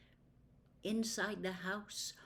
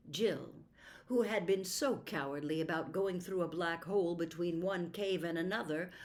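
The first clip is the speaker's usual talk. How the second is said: ordinary delivery, nothing marked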